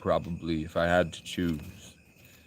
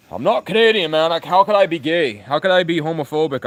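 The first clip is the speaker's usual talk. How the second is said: loud, higher-pitched indignation; indignant protest; 6 semitones higher, 5 dB louder than usual. Real, not acted. Streamer denying chat's claims, indignant protest. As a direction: protest it hotly, pitch jumping up.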